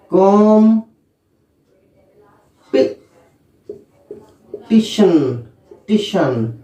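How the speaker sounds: कॉम्पिटिशन stressed at the start, then टिशन separately; split pronunciation. Shown as a demonstration. Teacher demonstrating syllables, deliberate. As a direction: break कॉम्पिटिशन into kom and टिशन. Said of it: "ऐसे".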